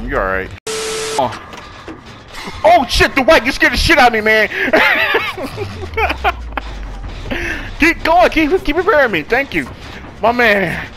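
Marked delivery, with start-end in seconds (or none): 4.5-7.7 long high laugh of relief. Real, not acted. Player laughs after teammate scared them, long high laugh.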